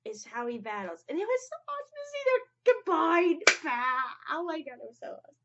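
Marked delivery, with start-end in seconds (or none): 1.1-5.4 high, loud squeal of delight; overjoyed fan squealing about the fusion; excited gushing. Real, not acted. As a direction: burst out with fan excitement, fast.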